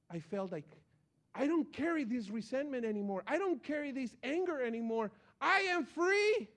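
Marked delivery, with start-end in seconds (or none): 1.3-6.5 rising, louder, ending much higher on free; joyful release. Retold or imitated. A preacher telling his church the release he felt, rising joy. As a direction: proclaim your freedom with growing joy.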